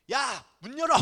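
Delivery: loud, high yell through a door; sudden mock-scolding shout; angry parent shout. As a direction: act a parent demanding entry. Acts parent yelling at shut door.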